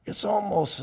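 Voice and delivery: normal talking voice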